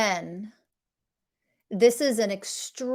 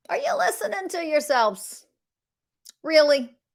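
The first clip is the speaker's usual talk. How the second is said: high-pitched, exasperated disbelief; can't-believe-it questioning; incredulous scolding; 7 semitones higher than usual. Real, not acted. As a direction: call them out with raised pitch.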